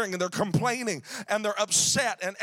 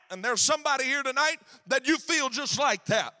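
ordinary talk, nothing marked

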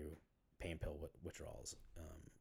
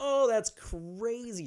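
high, slow put-on amused voice; overly interested fake reaction, much higher and slow; mock fake laugh-along; 13 dB louder, much slower than usual. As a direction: play it up as a forced polite reaction. Mimics himself covering on date.

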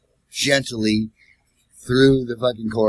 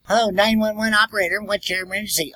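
higher, brisk operator voice; 911 operator; 10 semitones higher than usual. Retold or imitated. The 911 operator picking up the driver's call. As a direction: answer like a bored dispatcher.

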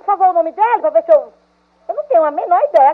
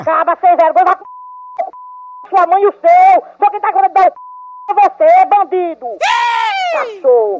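angry insults; slower than usual. Real, not acted. Woman furiously insults the prank caller. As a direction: hurl the insults at him.

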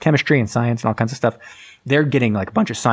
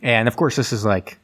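ordinary delivery

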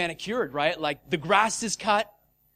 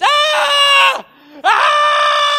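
high, loud drawn-out scream; piercing mock scream, held long; imitated scream; 15 dB louder than usual. Imitating screams heard from a bench, shrieking. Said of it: "all we hear is".

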